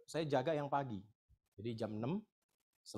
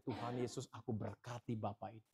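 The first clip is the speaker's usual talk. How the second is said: soft, hushed prayer of blessing; quiet blessing; 6 semitones lower, 7 dB quieter than usual. Retold or imitated. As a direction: pray gently for the man. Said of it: "saya cuma bilang gini".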